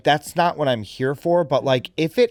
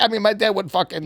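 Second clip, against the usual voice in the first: normal talking voice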